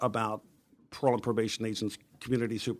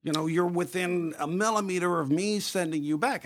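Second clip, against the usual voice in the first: exasperated scolding, higher and drawn out; retold fed-up reprimand, pitched up; livid telling-off; 5 semitones higher than usual. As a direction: read someone the riot act, imitated. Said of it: "she was just livid".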